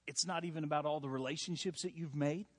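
ordinary delivery

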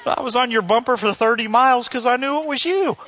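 high, loud put-on backpedalling; hammed-up cheerful cover story; mock excuse. Honker's excuse, exaggerated by pastor.

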